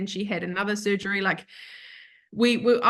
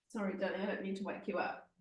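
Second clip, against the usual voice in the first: much softer, quick apology to a sleepy pup; soft to a puppy; 14 dB quieter, faster than usual. Real, not acted. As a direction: murmur so you don't wake her.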